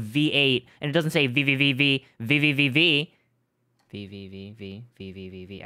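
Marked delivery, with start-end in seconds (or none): 3.9-5.6 much softer, lower rapid V's under the breath; muttered counting. Pretend. Streamer counting letters quietly to herself.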